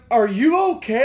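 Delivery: high, loud call of concern; imitated girlfriend. Girlfriend checking on frantic boyfriend, imitated shout. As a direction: mimic her shouting over. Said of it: "She goes".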